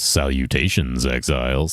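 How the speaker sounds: low, slow, straightforward greeting; Jesus's straightforward deep tone. Acted. Streamer showing how he usually greets exiles, deep straightforward tone. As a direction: speak deep and straight into the mic, unhurried. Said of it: "he gets like this and just goes".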